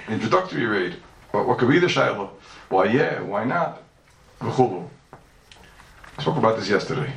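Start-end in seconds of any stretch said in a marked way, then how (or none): none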